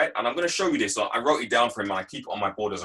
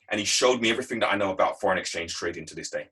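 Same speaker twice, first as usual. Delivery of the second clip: normal talking voice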